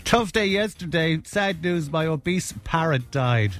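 slow, mock-grave announcement; slow mock-solemn. Shown as a demonstration. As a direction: set up the joke slowly and solemnly. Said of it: "the thing a while ago".

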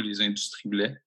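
usual speaking voice

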